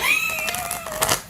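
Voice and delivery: a very high shriek of laughter. Real, not acted. Reader shrieking with laughter at the reading. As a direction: let out a piercing laugh.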